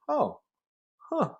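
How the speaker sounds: high, drawn-out fake interest; lightly voiced skeptical huh; mock surprise. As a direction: act unconvinced behind a polite oh. Her own skeptical reply.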